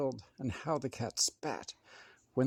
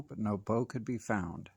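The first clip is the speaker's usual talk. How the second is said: ordinary delivery, nothing marked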